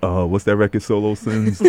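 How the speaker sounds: ordinary talk, nothing marked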